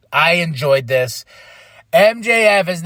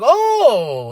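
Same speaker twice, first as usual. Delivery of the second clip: surprised oh; 7 semitones higher, 4 dB louder than usual. Real, not acted. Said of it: "I was like".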